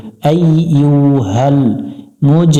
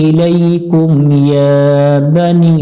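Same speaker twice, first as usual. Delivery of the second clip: slow drawn-out tajweed chant; Quran recitation; much slower than usual. Acted. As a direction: recite each word long and sung. Teacher recites full verse.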